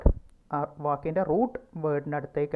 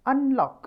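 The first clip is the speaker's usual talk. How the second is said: Unlock with lock punched high and loud; stress after un; 9 semitones higher, 6 dB louder than usual. Shown as a demonstration. Teacher modelling Unlock for the class, stress on lock. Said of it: "അപ്പോൾ un എന്നതിന് ശേഷമാണ് അവിടെ stress വരുന്നത്".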